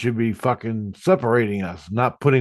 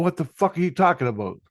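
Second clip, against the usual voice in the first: quick, higher, incredulous retold question; baffled retort; 7 semitones higher, faster than usual. Answering the sainthood announcement, incredulous retort. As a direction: fire back a baffled question.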